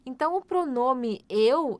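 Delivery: usual speaking voice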